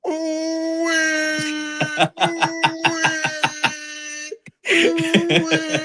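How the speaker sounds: long, high held "ooh wee"; high drawn-out whoop. Pretend. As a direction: welcome the guest with sustained high hollers. Hollers to welcome the guest.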